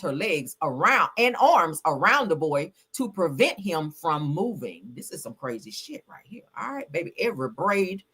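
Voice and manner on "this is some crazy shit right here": hushed whispered aside. Real, not acted. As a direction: drop to a whisper, like sharing gossip. Aside about crazy airport story.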